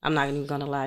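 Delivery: normal talking voice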